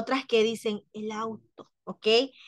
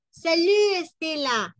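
high, louder call of salut; calling a greeting; 6 semitones higher, 4 dB louder, slower than usual. Pretend. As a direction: greet her in passing, loud and up. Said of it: "y le dice". (saludo rápido y agudo a Estela)

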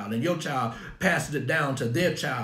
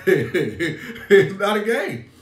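drawn out through laughter; very slow, laughing repeat; 5 semitones higher, 7 dB louder, much slower than usual. Real, not acted. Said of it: "And no, it's not a game".

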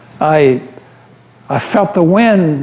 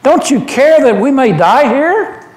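higher, pleading and frightened; desperate plea; 5 semitones higher than usual. Acted. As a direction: beg the master as the boat sinks. Disciples terrified in the storm.

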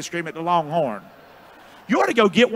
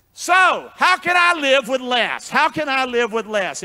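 loud, slow and high, leaning on "I"; booming question; 4 semitones higher, 4 dB louder than usual. Acted. Preacher booming the sermon's question at the crowd. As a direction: boom the question out to the hall.

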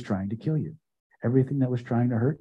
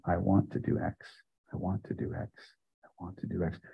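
like a small voice at the back of the head; quiet inner murmur; little inner voice; 5 semitones lower, 9 dB quieter than usual. Shown as a demonstration. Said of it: "a little voice in the back of your head".

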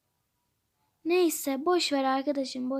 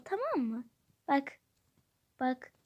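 normal talking voice

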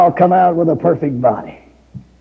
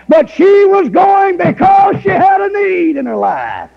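preaching at full force; 9 semitones higher than usual. Acted. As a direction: build to a high ringing peak.

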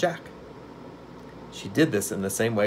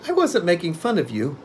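put-on higher boy's voice in protest; 9 semitones higher than usual. A narrator's reading. Boy defending himself to the angry witch, protesting. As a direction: protest innocence in a raised pitch. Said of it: "protested Tip".

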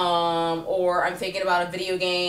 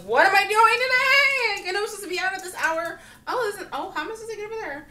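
high, frantic mock panic over the day's plans; flustered self; 11 semitones higher, 4 dB louder than usual. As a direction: squeal at the phone, totally lost. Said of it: "I'm looking at my phone like".

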